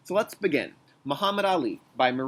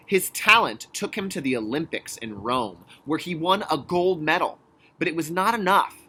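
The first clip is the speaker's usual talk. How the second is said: louder, building storybook reading; energetic picture-book reading; reading to children; 3 semitones higher, 5 dB louder than usual. Acted. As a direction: pump up the boxing tale for kids. Reads the picture book aloud.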